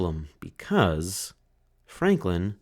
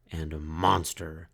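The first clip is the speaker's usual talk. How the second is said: plain reading voice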